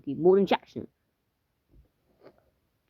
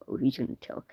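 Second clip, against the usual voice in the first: ordinary talk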